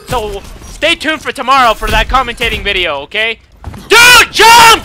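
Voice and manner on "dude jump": high, loud, exasperated shout; yelling. Real, not acted. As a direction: yell the order at a teammate. Yelling at the game.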